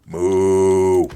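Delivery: loud, long level moo; cow moo. Pretend. Player mooing back at a cow, cow imitation.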